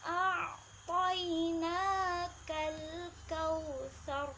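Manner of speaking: slow melodic tajwid chant; slow chanted Quranic recitation. Shown as a demonstration. Teacher recites verse one.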